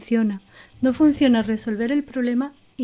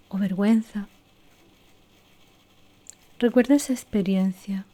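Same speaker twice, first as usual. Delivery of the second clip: guided meditation. Acted. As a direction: lead them inward gently and unhurried. Leads a guided recall exercise.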